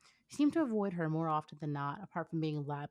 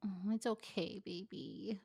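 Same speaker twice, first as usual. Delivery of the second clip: muttered; 3 semitones higher, 5 dB quieter, slower than usual. Acted. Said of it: "Ino muttered".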